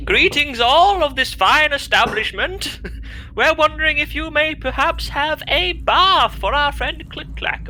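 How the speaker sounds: posh character voice. Acted. Player voices posh character at bar.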